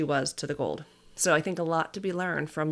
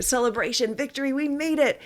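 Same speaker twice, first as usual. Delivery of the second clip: mock celebration; 8 semitones higher than usual. Family celebrating the last park.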